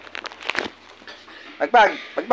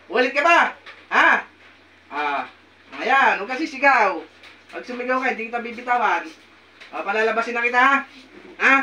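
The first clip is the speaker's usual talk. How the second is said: usual speaking voice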